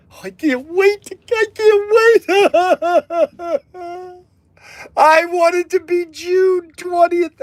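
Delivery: high, squealing can't-wait glee; high-pitched giddy squeal; giddy excitement. Acted. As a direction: bounce off the walls with joy. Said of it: "I gotta calm down".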